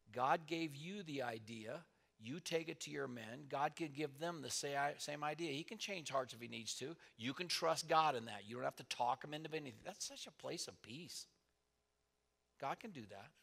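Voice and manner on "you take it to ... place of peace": ordinary talk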